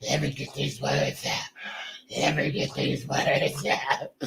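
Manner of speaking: mock weak voice. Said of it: "they don't know strength".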